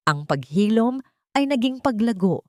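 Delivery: ordinary delivery, nothing marked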